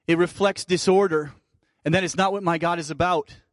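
usual speaking voice

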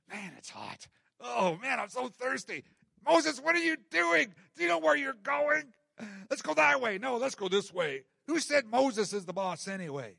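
whiny grumble building to high, loud griping; mock complaining. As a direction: act out a crowd griping at their leader. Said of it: "they had been complaining".

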